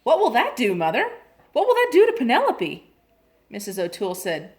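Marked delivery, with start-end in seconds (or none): 0.0-2.9 much higher and louder, eager; an excited girl asking her mother. A narrator's reading. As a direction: bounce with curiosity. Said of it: "bounced up and down excitedly".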